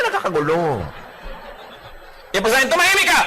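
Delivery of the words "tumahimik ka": high, loud barked order; angry shout. Acted. Spouse shouting the other into silence. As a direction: snap it like an order.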